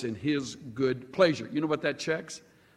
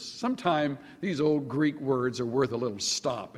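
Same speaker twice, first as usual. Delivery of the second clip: normal talking voice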